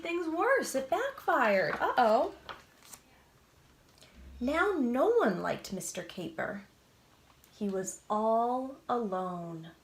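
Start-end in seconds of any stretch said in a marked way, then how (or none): none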